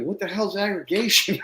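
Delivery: high-pitched mock incredulity; retold bafflement. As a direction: imitate a baffled old-timer. Old neighbor baffled by the word.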